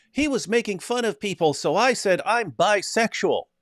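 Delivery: high-pitched mock little boy voice; imitating a child. Retelling a TV show, voicing the boy Noah in a high mock child voice. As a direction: pipe it up like a smug kid. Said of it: "Noah says".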